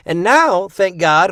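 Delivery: normal talking voice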